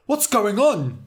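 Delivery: loud, high, panicked question; alarmed cry. A narrator's reading. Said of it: "cried Morg".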